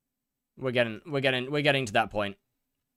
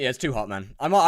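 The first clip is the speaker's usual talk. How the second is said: ordinary talk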